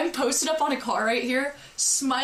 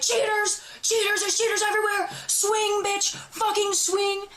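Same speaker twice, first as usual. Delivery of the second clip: high-pitched mock screech, goading; retold screeching; 8 semitones higher than usual. Daring the girl to hit her, high mock screeching. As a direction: imitate screeching taunts, pitched way up without full volume. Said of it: "now I'm screeching".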